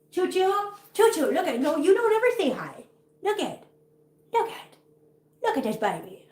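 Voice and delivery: high, sing-song coo to a pet. Real, not acted. Talking to her cat.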